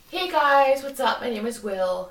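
loud, upbeat YouTuber intro; hammed-up fitness YouTuber greeting; imitating Will Tennyson. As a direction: mimic a cheerful fitness vlogger opener. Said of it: "he goes".

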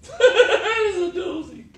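very high, slow laugh through the words. Real, not acted. Laughing at the tough matchup for the listener. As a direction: dissolve into laughter on it.

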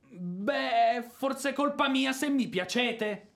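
flustered outburst of feeling; flustered confession. Acted. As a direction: blurt it out despite yourself. He blurts out his attraction.

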